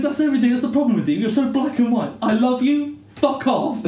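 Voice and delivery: high, loud mock argument; hammed-up exasperated outburst; acted row. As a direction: rattle off a heated retort, amused. Acted quarrel from the show.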